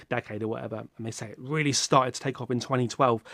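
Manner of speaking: ordinary talk, nothing marked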